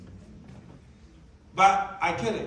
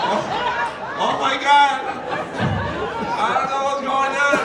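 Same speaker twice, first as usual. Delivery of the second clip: higher, louder, laughing through it; laughing mock panic; 4 semitones higher, 4 dB louder than usual. Passenger on a shaking plane, laughing mock panic. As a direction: play the scared passenger half-laughing.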